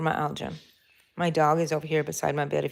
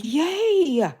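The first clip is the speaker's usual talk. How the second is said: high squealed "Yay!" twice; retold happy squeal, much higher; excited yay; 11 semitones higher, 4 dB louder than usual. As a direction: cheer like a thrilled kid at a party. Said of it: "I was like".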